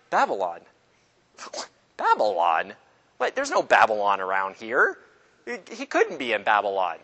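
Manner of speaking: low voice swooping up in puzzled questions; hammed-up puzzlement; mock bafflement. Acts baffled hunters reading code name.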